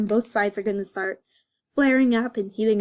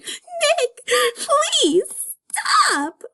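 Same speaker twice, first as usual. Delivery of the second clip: much higher, loud laugh-crying; mock weeping; hammed-up happy sobbing; 11 semitones higher, 6 dB louder than usual. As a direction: sob with joy, overdone. Over-the-top crying joy.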